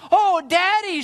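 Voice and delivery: high, loud cry of joy; jubilant cry. A narrator's reading. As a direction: cry out to Daddy, overjoyed. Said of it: "this time with jubilance".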